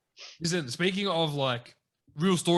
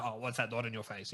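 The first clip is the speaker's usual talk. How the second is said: softer, low-key mock Lance asking about the mole; lightly voiced relaxed kid, much softer; chill imitation of Lance; 5 semitones lower, 10 dB quieter than usual. School friend curious about the mole, retold in soft, chill imitation. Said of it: "chill with his voice".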